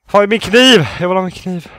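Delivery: loud, high-pitched panic, easing off slightly; panicky urgency. Real, not acted. Player wants a knife in the game, panicky outburst. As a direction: call urgently for your weapon.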